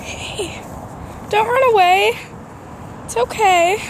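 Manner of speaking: high, gentle coaxing; coaxing a wild bird. Real, not acted. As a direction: sweet-talk a shy little bird. Calling softly to a bird.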